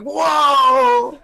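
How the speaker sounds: reenacted cry of amazement; startled whoa. Telling co-host about the freakout, startled whoa. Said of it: "I was like".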